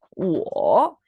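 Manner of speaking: "Wo" baja y vuelve a subir; tercer tono. Shown as a demonstration. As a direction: di "Wo" con el tono tres. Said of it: "tercer tono". (pronombre chino en tercer tono)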